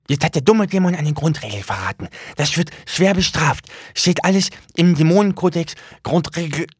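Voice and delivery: high excited little demon voice; agitated demon. Acted. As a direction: rattle off the rule in alarm. Demon frets over betrayed rule.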